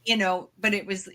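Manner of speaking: ordinary talk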